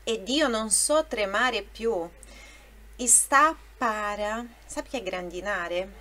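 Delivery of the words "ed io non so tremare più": slow, deliberate reading of the lyric; slow careful recital. Shown as a demonstration. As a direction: say each word slowly for students. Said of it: "a sílaba tônica é grandinare".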